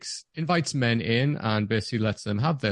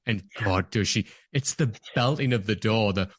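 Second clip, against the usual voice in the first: normal talking voice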